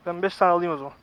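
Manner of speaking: ordinary delivery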